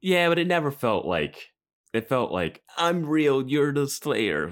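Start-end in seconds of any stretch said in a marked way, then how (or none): 2.8-4.5 high, loud mock squabble, dragged out at the end; hammed-up bickering taunt. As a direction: imitate an overblown argument, pitched way up. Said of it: "like that kind of bickering".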